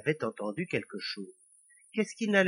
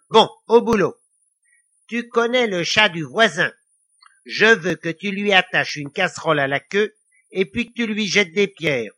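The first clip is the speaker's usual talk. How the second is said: louder, higher, brisk devilish orders; scheming devil; 4 semitones higher, 8 dB louder than usual. A narrator's reading. Devil ordering Lily to mischief, bossy.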